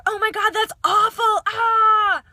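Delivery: high, loud, shrieking overreaction; mock a customer-service panic, wailing ah; hammed-up alarm at a customer's problem; over-the-top panic. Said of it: "you do not want to start yelling at them or say".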